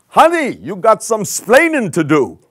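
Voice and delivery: loud, high Cuban American accent, hammed-up. Ricky scolding Lucy on coming home, in a hammed-up Cuban American accent. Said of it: "he would say to her in his Cuban American accent".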